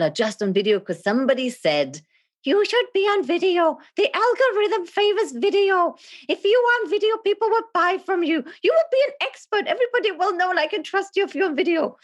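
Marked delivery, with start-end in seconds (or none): 2.4-12.0 very high, squeaky parroting voice; mock advice-giver. Somebody nagging people onto video, high-pitched mocking imitation.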